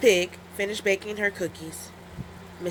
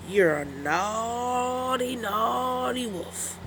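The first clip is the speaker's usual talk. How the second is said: very slow, soft turtle scolding; slow Mister Turtle; 4 semitones higher, 4 dB quieter, much slower than usual. Acted. Mister Turtle chides the wolf, very slow soft voice. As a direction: drag out each naughty. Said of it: "added Mister Turtle".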